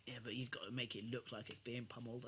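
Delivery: ordinary talk, nothing marked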